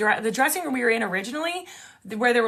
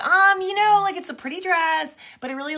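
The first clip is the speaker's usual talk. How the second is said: hesitant tact; 7 semitones higher, 4 dB louder than usual. Retold or imitated. Tactfully critiquing a stranger's dress.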